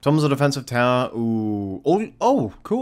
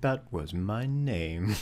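slow, soft impression of a wizard; Ian McKellen; 2 semitones lower, 10 dB quieter, much slower than usual. Player quotes Gandalf's line, soft slow impression. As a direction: murmur it slowly, imitating the actor. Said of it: "I love the way Ian McKellen says that".

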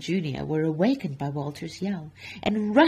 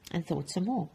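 ordinary delivery

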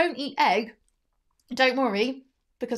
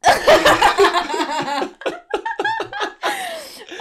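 loud, high helpless laugh; high burst of laughter; 9 dB louder than usual. Real, not acted. Laughing at friend's face.

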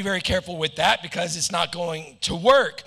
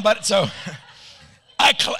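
ordinary delivery

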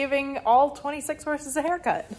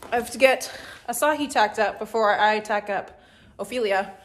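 ordinary delivery

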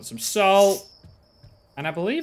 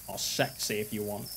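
much softer, low mock-sexy murmur; mock seductive; 8 semitones lower, 12 dB quieter than usual. Worker joking to staring customer, soft mock-sultry murmur. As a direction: drop into a teasing sultry hush.

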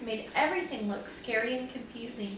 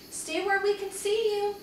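high, raised calling voice; pitched-up warning call; 9 semitones higher, 4 dB louder than usual. A narrator's reading. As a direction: call after a child across the grass. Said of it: "Henry called".

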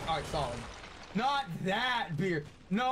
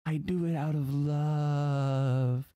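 Panda ASMR voice; 4 semitones lower, 4 dB louder, much slower than usual. Shown as a demonstration. Streamer showing chat the ASMR voice out of love. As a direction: croon it slow and close to the mic. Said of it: "would I do the Panda A S M R voice".

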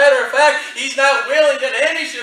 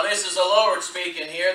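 ordinary talk

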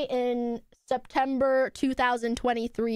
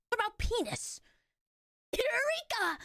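high, breathy anime exclamation; anime character; 7 semitones higher, 5 dB quieter than usual. Acted. Playing her own clip, anime-style cry. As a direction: announce it like an excited anime hero. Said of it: "Why did I say it like an anime character?".

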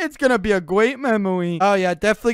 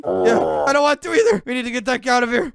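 ordinary delivery

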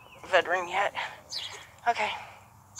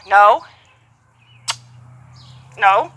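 sharp loud correction; firm loud no; 10 dB louder than usual. Real, not acted. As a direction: snap a firm no at the horse. Correcting horse firmly.

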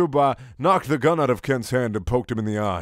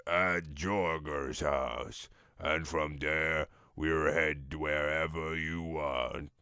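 low mock voice; 7 semitones lower, slower than usual. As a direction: drop low and slow like a shady caller. Character voiced lower on phone.

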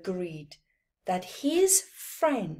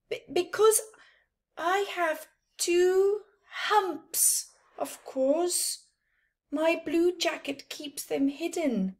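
shy character voice; 9 semitones higher than usual. Acted. Shy camel voice.

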